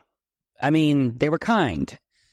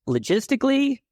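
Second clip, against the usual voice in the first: wry emphasis; 12 semitones higher than usual. Real, not acted. Mocking his upbringing to the host, ironic logistically. Ironic word choice, pitched up.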